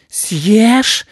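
very high, loud yell; piercing cry from a hare; piercing shriek. A narrator's reading. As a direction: shriek it out at full pitch. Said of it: "раздался пронзительный вопль".

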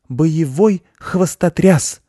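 high, very slow announcement; proud hare. A narrator's reading. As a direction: declare it proudly, word by word. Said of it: "сказал заяц гордо".

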